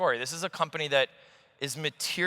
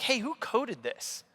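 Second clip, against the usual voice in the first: condescending doubt; 9 semitones higher, 4 dB quieter, much slower than usual. Retold or imitated. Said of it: "implicit in my voice was like".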